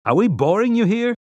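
high, sharp annoyed question; irritated. Acted. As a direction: let the irritation show. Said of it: "keep the irritation out of his voice ... failed".

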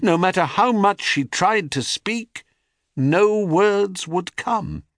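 usual speaking voice

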